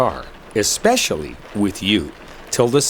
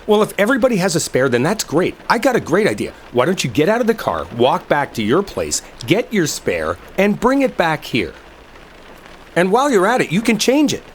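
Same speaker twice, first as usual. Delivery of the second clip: higher, faster mocking rant at the partner; sarcastic mockery; 8 semitones higher than usual. Stranded driver mocks partner's useless spare tire idea, sarcastic and pitched up. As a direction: throw the idea back in their face, pitched up.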